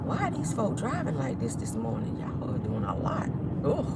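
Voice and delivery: muttering at other drivers in traffic; annoyed driver grumbling under breath; road rage. Real, not acted. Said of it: "a little bit of road rage".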